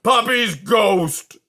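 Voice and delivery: slow, loud, high scream. A narrator's reading. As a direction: scream it out, drawn out. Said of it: "screamed Aerith at the top of his voice".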